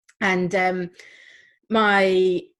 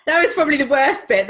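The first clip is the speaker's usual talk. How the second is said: usual speaking voice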